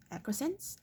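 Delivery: Acquiescence? asked high and soft, rising at the end; puzzled rising query. Shown as a demonstration. Reader checking a hard word, rising question.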